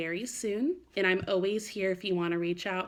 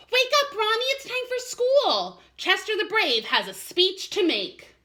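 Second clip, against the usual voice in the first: put-on high, loud excited kid; excited wake-up call; 13 semitones higher, 6 dB louder, slower than usual. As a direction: bounce on your brother and call him up. Waking brother with a leap.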